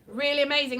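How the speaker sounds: normal talking voice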